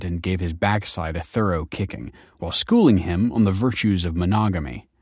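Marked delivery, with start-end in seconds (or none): none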